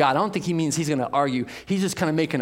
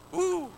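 high excited woo; excited whoop. Real, not acted. Preacher thrilled by the verse, high excited whoop. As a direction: let out a sudden high whoop.

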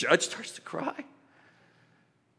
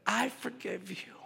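tender forgiveness; 6 semitones higher, 8 dB quieter than usual. Retold or imitated. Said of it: "they said".

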